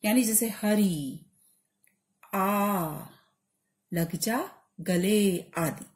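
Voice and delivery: slow, with the vowels of हरि and आ held long; prolonged vowels. Shown as a demonstration. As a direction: stretch हरि, आ and गले like a call from afar. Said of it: "इसको हम प्लुत स्वर कहते हैं". (हरि और आ को लंबा खींचकर धीरे बोलिए)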